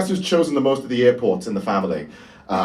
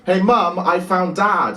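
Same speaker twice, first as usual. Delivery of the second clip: put-on a kid calling out, higher; little sister calling out; 7 semitones higher, slower than usual. Little sister calling across Westfield to mum. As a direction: call out to mum like a little girl.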